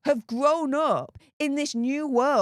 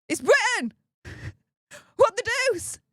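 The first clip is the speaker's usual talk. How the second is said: mock posh outrage; 9 semitones higher than usual. Mock outraged Brit exclamation.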